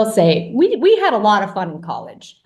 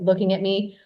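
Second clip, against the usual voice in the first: ordinary talk, nothing marked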